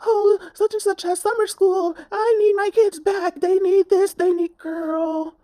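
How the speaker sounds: high, loud, fake-sobbing Karen; mock crying Karen. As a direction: whine it like a hysterical Karen. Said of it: "talking about".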